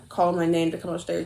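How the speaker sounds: ordinary delivery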